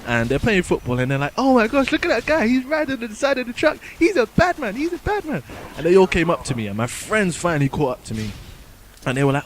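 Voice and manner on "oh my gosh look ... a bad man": put-on high, excited kids pointing and gasping; squeaky imitation of awed kids; mock kids' voices. Kids on the pitch gawking at him on the truck, mock high voices.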